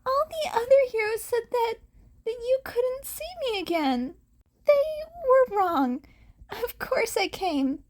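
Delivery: high and tender, eager; high hero voice, earnest. Acted.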